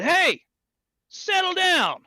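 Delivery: high, loud call to calm him; shouting out the window. Retold or imitated. Shouting at the angry man from the car window, loud and high. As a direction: yell across the lot to shut him up.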